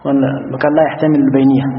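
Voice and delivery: ordinary delivery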